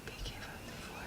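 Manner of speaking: hushed whisper of "P K five two four". Real, not acted. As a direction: whisper the name to yourself. Reading long product name.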